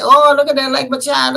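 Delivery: mocking. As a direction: sneer at the boy. Villagers mock Elegba.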